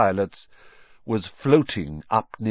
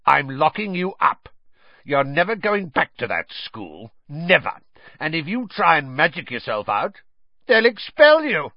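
high, mounting triumphant rage; gloating fury; 6 semitones higher, 4 dB louder than usual. A narrator's reading. Uncle threatening to lock Harry up, gloating fury. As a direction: build from firm threat to manic shriek.

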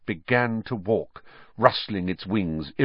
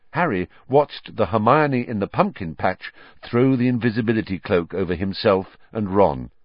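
ordinary delivery, nothing marked

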